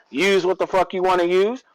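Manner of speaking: usual speaking voice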